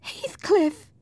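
high, anguished call; high-pitched, emotional cry; dying lover. Acted. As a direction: call his name in a high voice. Dying woman calls her lover.

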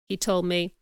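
ordinary delivery, nothing marked

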